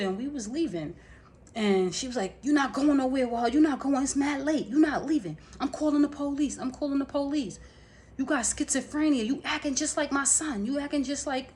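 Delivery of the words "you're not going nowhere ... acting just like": high-pitched, insistent mimicked ranting; agitated threats. Sister stopping them leaving late at night, agitated. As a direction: imitate a sister forbidding and threatening.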